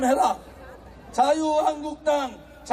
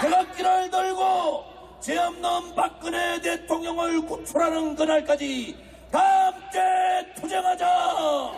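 shouted rally slogan; 4 semitones higher than usual. Acted.

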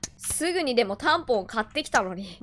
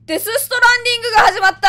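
excited shout; 11 semitones higher, 9 dB louder, faster than usual. Real, not acted. Excited game comparison.

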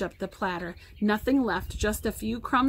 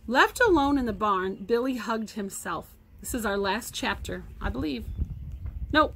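usual speaking voice

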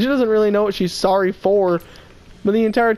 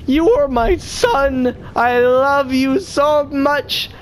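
put-on drunk lady; 5 semitones higher, much slower than usual. Drunk lady voiced in story.

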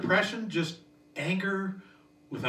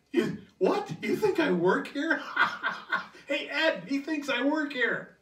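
higher, laughing scoff at the customer; mocking laugh; 9 semitones higher, slower than usual. Rude customer scoffing at the clerk, laughing mockery. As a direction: laugh at the idea you work here. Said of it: "No, he says".

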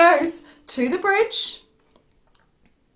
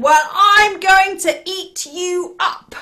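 slow, loud, raised troll threat; menacing troll; 7 semitones higher, 6 dB louder, slower than usual. Acted. Terrible troll threatens the little goat, slow and loud. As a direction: draw out the threat with relish.

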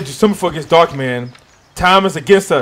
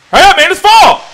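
much higher and louder, pleading with the tree; impatient yell; 14 semitones higher, 12 dB louder than usual. Real, not acted. Urging the tree to fall.

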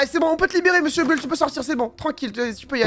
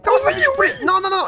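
shrill shout of horror at the offer; high shocked outburst; outraged shock; 14 semitones higher, 3 dB louder, faster than usual. Acted. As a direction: scream the question in disbelief. Outraged chicken refuses chicken.

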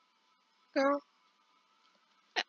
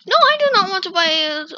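raised and insistent, higher; firm loud refusal; 5 semitones higher, 8 dB louder, faster than usual. Real, not acted. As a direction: push back hard. Refusing the dentist's offer.

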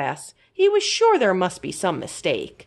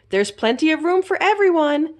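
bright, louder teacher voice; cheerful teacher; 9 semitones higher, 4 dB louder than usual. A narrator's reading. Teacher welcoming children onto the bus, cheerful. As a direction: call out to the class like a cheery teacher. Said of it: "Miss Maxwell said".

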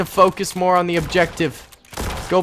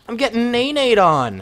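ordinary talk

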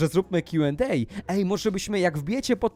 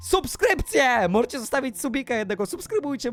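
excited announcement; 5 semitones higher than usual. Real, not acted. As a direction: stretch the word out with excitement. Calls for subscriptions.

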